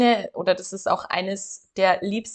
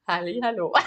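laughing; 3 semitones lower, 4 dB quieter, faster than usual. Real, not acted. Laughing greeting after announcement.

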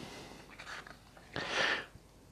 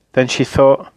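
ordinary delivery

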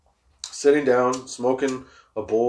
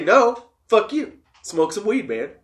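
higher, slow playful comeback; mock retort; 8 semitones higher, slower than usual. To someone riled up, a higher playful brush-off. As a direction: toss back a jokey brush-off, much higher. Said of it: "if you say".

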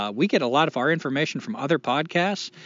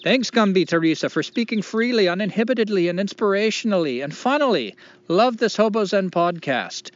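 higher-pitched, half-managed Irish lilt; attempted Irish accent; 7 semitones higher than usual. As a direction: keep reading in a shaky attempt at Irish. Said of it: "I cannot do an Irish accent".

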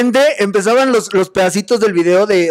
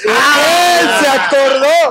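excited outburst; 5 semitones higher, much slower than usual. Real, not acted. Host delighted the guest remembered the quote, high excited cry. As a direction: burst out thrilled that he remembered.

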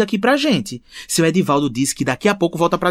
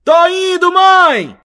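high, loud call back; calling from far away; 10 semitones higher, 6 dB louder, much slower than usual. Acted. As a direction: answer from across the house. Son answering from another room.

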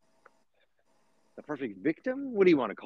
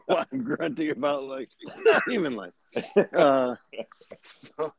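laughing; 5 semitones higher than usual. Real, not acted. Laughing at own grunting.

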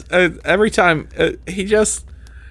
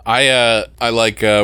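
ordinary talk

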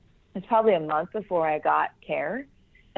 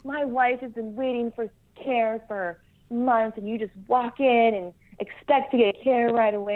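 higher-pitched mock indignant officer, not shouted; retold scolding; 5 semitones higher than usual. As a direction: imitate the boss's complaint, pitched up. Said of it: "who yelled at me".

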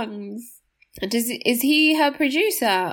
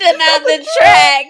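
loud laughing echo of the producer tag, higher; 5 semitones higher, 6 dB louder than usual. Pretend. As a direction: shout it back while laughing.

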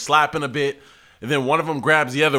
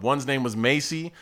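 ordinary talk

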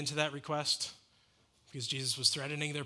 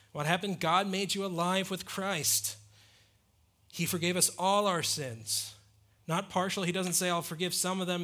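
louder, higher sermon voice building to a peak; fervent pulpit proclamation, louder and higher; full-force preaching; 4 semitones higher, 5 dB louder than usual. Acted. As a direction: preach it out with fire to the congregation.

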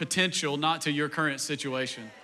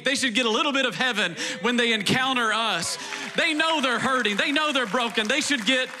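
preaching at full force; 7 semitones higher than usual. Acted. Pastor urging the church to lift people, fervent preaching. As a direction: rouse the congregation.

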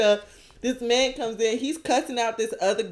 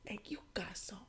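whisper; 4 semitones lower, 27 dB quieter than usual. Retold or imitated. He leans in and whispers.

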